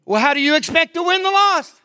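high, fast incredulous question; challenging retort. Retold or imitated. New believer questioning the pastor's wife, high and challenging.

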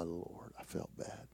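breathy whisper of shame; preacher whispering his regret; whispered remorse. Real, not acted. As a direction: let it drop to a whisper. Confessing failure, remorse.

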